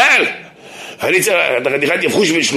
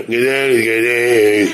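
very slow drawn-out mock kaddish; mock sluggish lifeless praying; 3 semitones lower, much slower than usual. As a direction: drag the prayer like a sleepy man. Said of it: "קדיש ככה".

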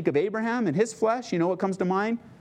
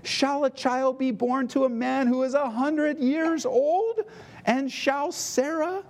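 higher and slow, incredulous; old man marvelling aloud, higher and slower; Abraham's doubting thoughts; 7 semitones higher, slower than usual. Acted. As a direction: stretch it out in disbelief. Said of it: "notice what he said in his heart".

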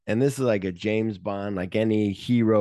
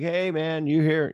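casual call; 7 semitones higher than usual. Retold or imitated. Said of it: "Sol Madrid's like".

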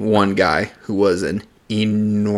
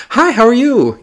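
high, polite phone greeting; customer service voice; 4 dB louder than usual. Shown as a demonstration. Clerk answering the store phone, customer service voice. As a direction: lift the pitch, overly nice on the phone. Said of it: "Use my customer service voice".

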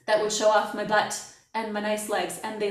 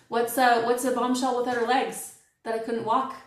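ordinary talk, nothing marked

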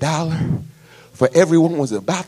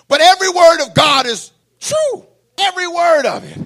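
preaching shout; 14 semitones higher, 7 dB louder than usual. Acted. Preaching at full force.

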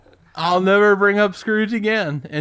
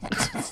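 high squeal of laughter; 10 dB quieter than usual. Real, not acted. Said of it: "Why is this so funny to you already?".

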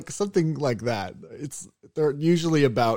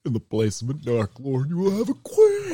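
Galadriel as Patrick Warburton; 4 semitones higher, 4 dB louder than usual. Acted. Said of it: "we do it all in Patrick Warburton's voice".

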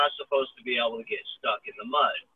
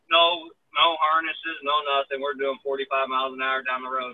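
ordinary delivery

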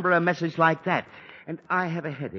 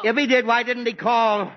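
higher, louder exasperated echo; losing patience with the relay; irritated repeat; 8 semitones higher, 6 dB louder than usual. Acted. Father repeats with rising irritation.

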